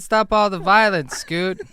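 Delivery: high, loud shout to be heard. Acted. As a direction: repeat it forcefully for someone who can't hear. Repeats louder for one who can't hear.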